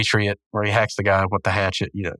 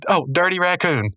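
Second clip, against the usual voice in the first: higher-pitched charades guess; lightly voiced Pops; 7 semitones higher than usual. Pops guessing what Rigby mimes, lightly put-on high voice. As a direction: imitate a cartoon guesser, pitched up. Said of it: "Pops goes".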